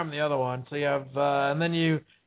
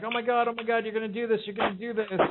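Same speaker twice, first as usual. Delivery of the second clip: higher, mildly panicked imitation; mock panic; 4 semitones higher, faster than usual. Frightened partner reacting to a threatened play, mock panic. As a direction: imitate someone starting to freak out.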